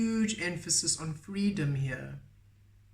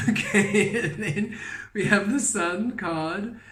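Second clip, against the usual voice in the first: higher, louder, talking through a laugh; 5 semitones higher, 6 dB louder than usual. Real, not acted. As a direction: carry on reading while still laughing. Laughing as she resumes the reading.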